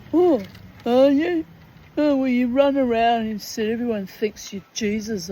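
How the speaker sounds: high, loud, sneering mock of a man's retort; mocking Steph voice. Retelling Steph's jibe to her, in a high mocking voice.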